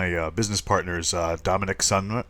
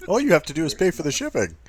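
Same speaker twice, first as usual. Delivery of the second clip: fast, higher rattled-off ad disclaimer; infomercial pitchman; 10 semitones higher, 4 dB louder, much faster than usual. Pretend. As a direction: rush it like ad fine print. ShamWow infomercial parody.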